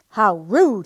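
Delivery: loud, offended outcry; indignant cry. A narrator's reading. Guinea pig stuck to the doll crying out indignantly. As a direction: cry out, insulted. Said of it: "he cried".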